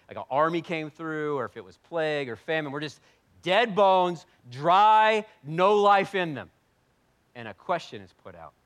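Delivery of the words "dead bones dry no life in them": loud, slow, emphatic preaching; loud slow sermon emphasis; preaching at full force. Acted. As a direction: hammer each word home.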